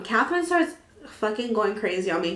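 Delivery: ordinary talk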